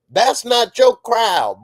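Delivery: loud, slow, stern warning; emphatic scolding. Acted. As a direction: lay down the law. Scolding the character.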